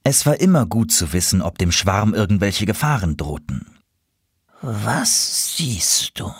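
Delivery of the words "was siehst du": very slow, suspenseful crow question; tense anticipation. A narrator's reading. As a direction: draw it out expectantly. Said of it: "fragte Konrad gespannt".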